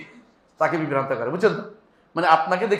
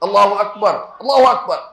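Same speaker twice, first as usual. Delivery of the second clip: proclaiming takbir; 5 dB louder than usual. Retold or imitated. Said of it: "রাসূলুল্লাহ ... কি বললেন".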